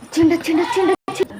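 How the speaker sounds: high, loud he's-coming call; urgent, thrilled warning; excited alert. Real, not acted. Target arriving, alerting the others.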